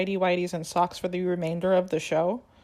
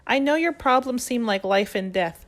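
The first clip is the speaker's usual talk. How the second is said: higher, louder patronizing mom; mocking mom voice; 5 semitones higher, 5 dB louder than usual. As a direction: talk down like a know-it-all mother. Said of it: "her mom says one of the funniest lines".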